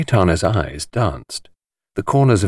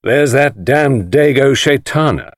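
slow, louder sneering Englishman; Englishmen's scorn; 5 semitones higher, 4 dB louder, slower than usual. A narrator's reading. Englishmen sneer at Chaitana, slow and louder. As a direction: sneer it like a bluff Briton. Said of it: "They said".

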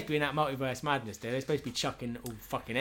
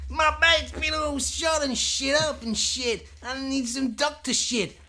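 much higher, louder, slow cartoon yell; mock shout; 12 semitones higher, 4 dB louder, slower than usual. A soldier begging for help, much higher slow mock shout. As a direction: bellow for a doctor.

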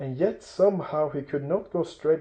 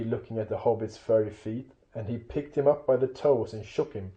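ordinary delivery, nothing marked

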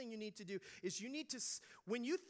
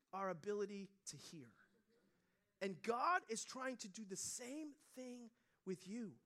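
ordinary talk, nothing marked

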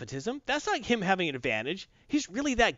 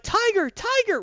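much higher, louder mock call after him; calling out Tiger; 4 dB louder than usual. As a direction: shout his name as she runs after him. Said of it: "She's like".

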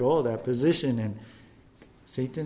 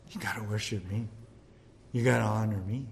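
sly devil murmur; 7 semitones lower, 9 dB quieter, faster than usual. Acted. Devil naming the catch.

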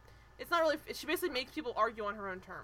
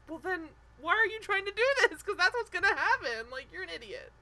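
higher, louder, exasperated snap at an idiot; exasperated retort; 7 semitones higher, 6 dB louder than usual. Retold or imitated. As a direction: tell her off, fed up and mocking. Speaker voices her own exasperation.

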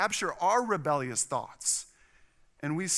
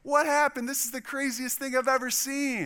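high, loud, amazed father-in-law; hammed-up astonishment, pitched way up; over-the-top excitement; 10 semitones higher, 6 dB louder than usual. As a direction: exclaim it far too enthusiastically. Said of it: "Tone it down".

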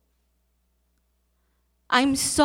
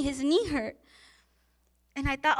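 ordinary talk, nothing marked

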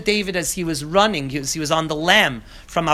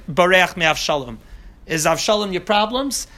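ordinary delivery

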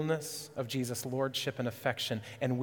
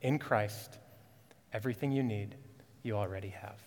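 everything you need, you already have, said softer, fading low; soft sincere repeat; 4 semitones lower, 6 dB quieter than usual. Acted. Pastor closing to church in the square, soft and sincere. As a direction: hand it to them quietly. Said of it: "hear this".

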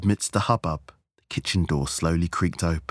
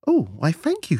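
flattered, blushing; 11 semitones higher, 4 dB louder than usual. A narrator's reading. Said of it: "Stephanie blushed".

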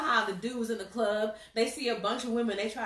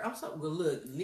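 ordinary delivery

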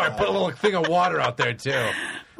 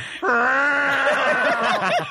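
long, high cat yowl; imitated cat yowl; 9 semitones higher, 4 dB louder than usual. Imitating the yelling backyard cats, long yowl. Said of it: "They're going".

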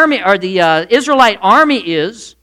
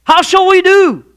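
much higher, louder flustered squeal; overexcited; 11 semitones higher, 6 dB louder than usual. Acted. Preacher mocking the flustered servant, overexcited squeal. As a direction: blurt it out in a frantic fluster. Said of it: "He's so excited he can't even get the words right".

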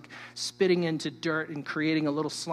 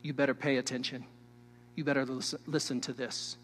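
softer and lower, leaning in; hushed solemn warning; 5 semitones lower, 7 dB quieter than usual. Real, not acted. Preacher warning the congregation, a hushed solemn aside. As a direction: quietly press them to listen. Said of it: "This is a solemn truth".

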